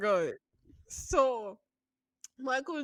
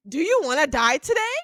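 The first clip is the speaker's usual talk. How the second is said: fast, louder threat; mock threat; 3 semitones higher, 8 dB louder, faster than usual. Threatening the flirting stranger, fast mock threat. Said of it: "So I was like".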